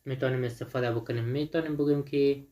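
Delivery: ordinary talk, nothing marked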